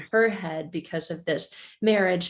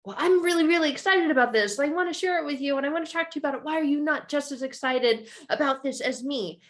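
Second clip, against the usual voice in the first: put-on higher, gushing kid, rushing the middle; excited kid; 8 semitones higher, faster than usual. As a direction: plead like a let-down child. Child pleading with dad.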